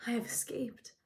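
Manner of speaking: breathless private whisper; hushed inner relief; whispered thought. Acted.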